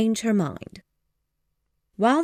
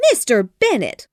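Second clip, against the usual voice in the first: higher, louder indignant outburst; angry cry; 4 semitones higher, 6 dB louder than usual. Acted. Wife rebuking husband, louder angry cry. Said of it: "cried his wife very angrily".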